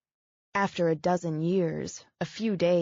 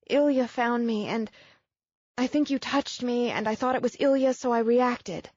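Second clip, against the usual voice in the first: put-on higher, rushed apologetic young woman; Abigail, distraught; 5 semitones higher than usual. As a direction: pour out the apology in a tumble. Narrator voices Abigail.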